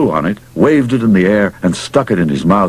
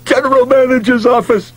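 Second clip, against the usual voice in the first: very high-pitched, strained yell without much volume; screaming into the phone. A narrator's reading. Manager calling the office by phone, screaming. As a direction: yelp it into the receiver, pitched right up. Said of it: "he screamed into it".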